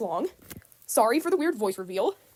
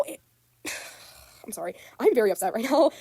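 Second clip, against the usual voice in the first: ordinary delivery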